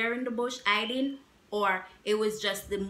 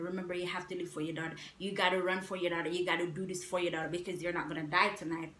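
fast, hushed pep talk to herself; mother running in fear, telling herself to keep going; urgent self-talk; 3 dB quieter, much faster than usual. Retold or imitated. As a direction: rush the words like a racing mind. Inner voice urging while running.

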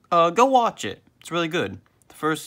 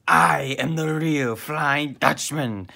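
slow, higher, drawn-out roar; mock scream; 4 semitones higher, 4 dB louder, slower than usual. A narrator's reading. Flying Dutchman bursting through the front doors, hammed-up slow scream. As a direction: proclaim it big and slow.

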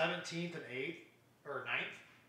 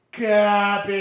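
emphatic loud; 3 semitones higher, 13 dB louder than usual. Real, not acted. Repeated verdict, much louder.

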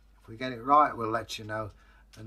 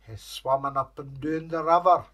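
ordinary delivery

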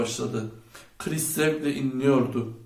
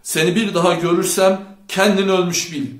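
loud, higher angry threat; raised, menacing yell; shouting; 5 semitones higher, 7 dB louder than usual. A narrator's reading. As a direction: shout a furious warning. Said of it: "diye bağırdı Chet".

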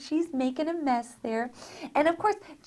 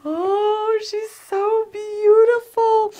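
delighted gasp; 10 semitones higher, 6 dB louder, much slower than usual. Real, not acted. Doll revealed from box.

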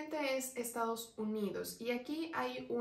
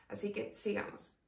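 ordinary talk, nothing marked

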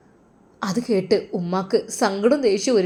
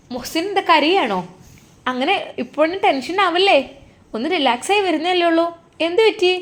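higher, brighter caring nurse; nurse's voice; 8 semitones higher than usual. Acted. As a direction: ask the patient kindly, voice lifted. Nurse checks on patient. (പരിചരിക്കുന്ന നഴ്സിന്റെ ഉയർന്ന ശബ്ദം)

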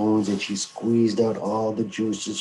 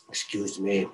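plain reading voice

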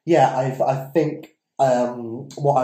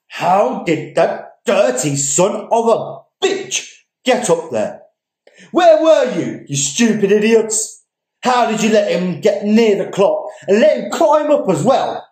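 higher and louder, barking at his men; an officer chewing out helpless policemen, furious shout; 10 semitones higher, 7 dB louder than usual. Acted. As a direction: tear into the stupid idiots. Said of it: "An officer almost bites the head off".

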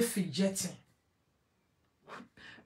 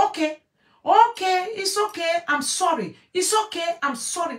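higher, louder pleading to calm her; placating apology; 8 semitones higher, 6 dB louder than usual. Retold or imitated. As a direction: hurriedly soothe an angry woman. Quoting man calming her down.

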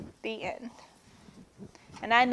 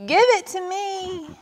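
high-pitched tantrum demand; mock shouted demand; 9 semitones higher than usual. A narrator's reading. Everyone keeps demanding it, shouting high. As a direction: call it out high, last word stretched. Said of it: "shouted everyone".

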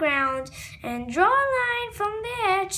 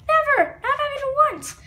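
higher and louder indignant cry; indignant protest; 8 semitones higher, 5 dB louder, faster than usual. Acted. Boy protesting to his dad, indignant and loud. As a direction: protest it with pride.

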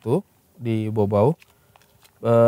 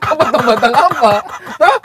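high, loud question through laughter; laughing teasing; 9 semitones higher, 7 dB louder than usual. Real, not acted. Co-host teases storyteller about what he cut, laughing loudly. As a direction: ask it while still cracking up.

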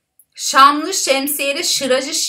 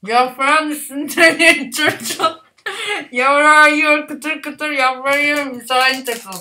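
cheeks stuffed full; 2 semitones higher than usual. Real, not acted. Third round, mouth filling up.

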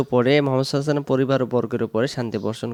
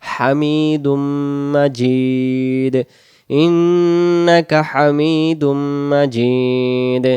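slow recitation; much slower than usual. Shown as a demonstration. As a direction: recite slowly, stretching vowels. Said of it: "মিমের জের এক আলিফ". (ধীর তিলাওয়াত)

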